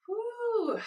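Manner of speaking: high loud whoo of joy; excited whoop. Real, not acted. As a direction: let out a bright whoop. Energetic movement exercise cheer.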